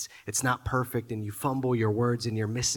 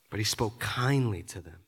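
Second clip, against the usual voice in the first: ordinary delivery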